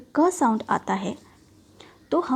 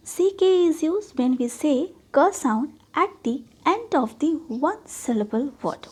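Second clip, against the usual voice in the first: slow rule reading; much slower than usual. Shown as a demonstration. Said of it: "सी के का use कब करें".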